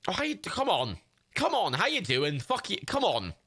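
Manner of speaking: exaggerated Scouse warm-up; trying out Scouse. Shown as a demonstration. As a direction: test a Liverpool accent, pitch jumping about. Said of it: "you gotta do it in the middle".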